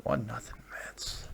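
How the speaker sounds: flat, soft repeat of the score, mostly breath; deflated mutter. Real, not acted. Host repeating a bad score to himself, muttered dejectedly. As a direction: sigh the score out in defeat.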